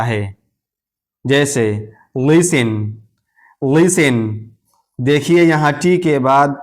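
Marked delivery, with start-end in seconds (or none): 2.2-4.2 लिसन with S straight into the ending; silent T. Shown as a demonstration. Teacher models listen for learners, लिसन with silent T. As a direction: say लिसन with no T sound. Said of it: "अब ये टी खामोश है".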